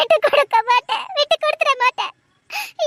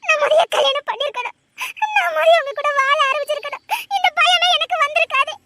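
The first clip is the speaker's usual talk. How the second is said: higher voice, crying through the words; tearful confession; 5 semitones higher than usual. Acted. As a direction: weep as you admit your fault. Frightened bride confessing, weeping. (அழுகையுடன் ஒப்புதல்)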